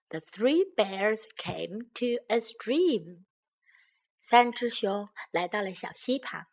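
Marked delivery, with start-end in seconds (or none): none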